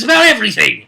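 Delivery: brisk narrator stress; fast emphatic. Shown as a demonstration. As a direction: rattle it off with emphasis. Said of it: "suspicious with him".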